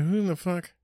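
high, far-off put-on voice; mock startled grumble. Himself reacting to a knock at his door, high mock alarm. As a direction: growl at the knock like a suspicious host.

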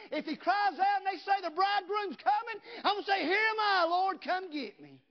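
loud, high, shouting revival preacher; full force preaching. Acted. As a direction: preach it out loud to the whole church.

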